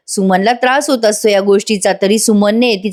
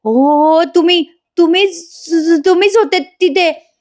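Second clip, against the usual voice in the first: high, stammering wife act; imitated dawning recognition; halting accusation; 7 semitones higher than usual. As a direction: haltingly point the blame, pitch climbing. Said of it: "Suman म्हणत असते".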